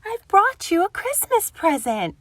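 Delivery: a friendly squirrel calling at the door; cheery Squirrel. Acted. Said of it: "he said cheerily".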